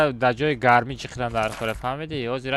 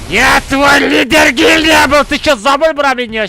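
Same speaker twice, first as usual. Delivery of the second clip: yelling; 12 semitones higher, 14 dB louder than usual. Real, not acted. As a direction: bellow the taunt at him. Repeats taunt yelling.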